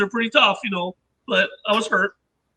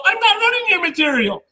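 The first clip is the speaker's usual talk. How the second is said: high-pitched, defensive retort; protesting denial; 8 semitones higher than usual. Retold or imitated. Inventor answers Dave's accusation about material, protesting denial. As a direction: imitate yourself protesting your innocence.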